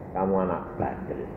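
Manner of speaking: low, hushed reproof; gentle divine scolding. Retold or imitated. God shaking his head at a stubborn child, low and soft. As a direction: chide softly in a deep voice.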